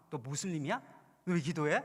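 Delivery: mocking tease. Friend teasing the praying coworker, mocking tone. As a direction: poke fun at his praying. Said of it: "막 이러면서 막 놀리더래요".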